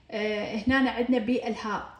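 ordinary delivery

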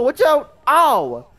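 loud warning, then an annoyed yelp; shout, get hit, then snap at your partner; yelling gamer. Real, not acted. Hit by partner's shot in game.

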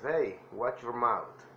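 "Hey, watch your mouth" said slowly, stressing "Hey"; drawn out for learners to copy; teacher demo of a warning phrase; slow model phrase. Shown as a demonstration. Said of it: "traten de imitar mi voz".

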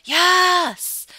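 long, loud, high victory yell; triumphant cheer. Real, not acted. Gamer just paid the bills, triumphant cheer. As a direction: let out a drawn-out whoop of "yes".

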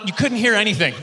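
ordinary talk

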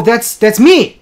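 normal talking voice

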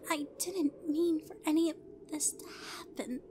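breathless, hushed whisper; near whisper. A narrator's reading. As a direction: barely voice the words, guilty. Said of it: "nearly whispering".

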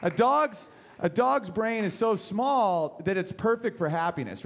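usual speaking voice

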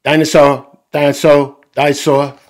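put-on excited toddler calling out, each one falling; George's toddler voice. A narrator's reading. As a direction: shout it like a little kid with one favorite word. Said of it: "George says".

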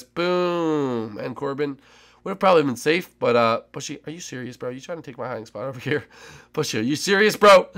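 ordinary talk, nothing marked

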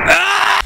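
sudden high startled scream; a jolt of fright, loud and high; real scream. Real, not acted. Jump scare in game. (cri de peur soudain)

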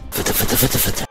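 unvoiced, creeping fıtı fıtı; sneaky whisper. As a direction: tiptoe sounds whispered to scare. (fısıltıyla gizlice yaklaşma)

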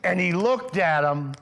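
a stern glare put into the voice; acted angry look. Shown as a demonstration. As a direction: say it like you're squinting him down in anger. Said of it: "with squinty eyes".

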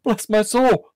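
very high, startled exclamation; astonished cry. A narrator's reading. Nephew Fred finds his uncle at the door, astonished cry. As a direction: cry out in happy amazement, pitch way up. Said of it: "cried Fred".

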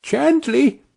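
high, soothing call to the horse; little boy calming a horse. A narrator's reading. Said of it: "Tom was calling out".

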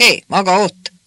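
loud call across the yard. A narrator's reading. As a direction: shout to get her attention. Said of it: "kiáltotta oda Márikának".

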